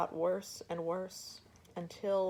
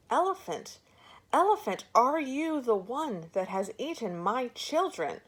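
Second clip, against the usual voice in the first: much higher and louder, furious scream; 6 semitones higher, 7 dB louder than usual. Acted. Furious mother screams at the elephant again. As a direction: scream at him in full fury. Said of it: "she screamed at him again".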